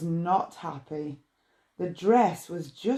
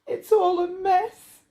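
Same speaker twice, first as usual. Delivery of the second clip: sobbing; 11 semitones higher, 4 dB louder than usual. Acted. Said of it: "She sobbed".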